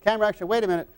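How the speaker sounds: director's call. Retold or imitated. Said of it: "they'd be go".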